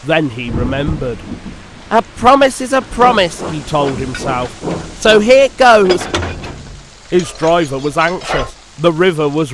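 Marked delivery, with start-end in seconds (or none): 1.9-3.4 high, determined little engine; Percy voice. Acted. 5.0-6.1 high, slow, resolute engine voice; determined. Acted. Percy bracing himself.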